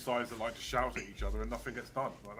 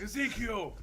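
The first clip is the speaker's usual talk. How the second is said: timid answer; 9 semitones higher, slower than usual. Retold or imitated. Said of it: "And he's like".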